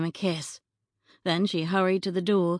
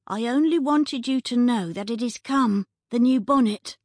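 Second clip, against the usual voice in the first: high, louder muffled through a door; muffled woman's voice; 6 semitones higher, 4 dB louder than usual. Acted. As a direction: speak from behind a closed door. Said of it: "a muffled woman's voice said".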